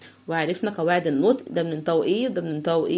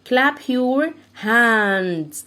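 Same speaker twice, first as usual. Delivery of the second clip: slow command; 2 semitones higher, 3 dB louder, much slower than usual. Shown as a demonstration. Teacher giving the clapping order, very slow. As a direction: clap your hands, very slow, clap stressed. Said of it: "كلاب يعني سقفوا".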